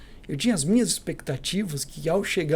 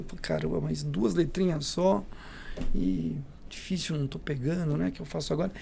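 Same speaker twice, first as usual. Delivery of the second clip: muttered panic; 6 dB quieter than usual. Retold or imitated. Intern panicking quietly mid-call.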